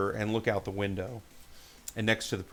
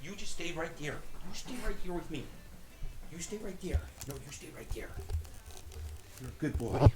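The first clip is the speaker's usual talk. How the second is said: higher, softer sing-song reassurance; soothing pet talk; 6 semitones higher, 4 dB quieter, faster than usual. Real, not acted. Soothing the dog Gabe.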